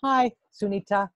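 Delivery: "Hi, Sunita" with a clear pause after "Hi". Shown as a demonstration. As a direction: say "Hi", stop, then "Sunita". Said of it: "That's how we would say the word out loud".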